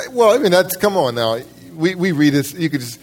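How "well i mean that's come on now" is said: ordinary delivery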